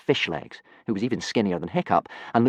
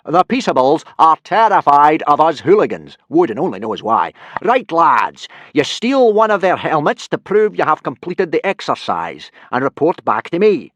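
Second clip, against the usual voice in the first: loud, higher blustering Viking; booming teacher; 8 semitones higher, 10 dB louder than usual. Acted. Viking teacher instructing boys in a booming voice. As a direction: lecture the lads at full volume. Gobber's voice held.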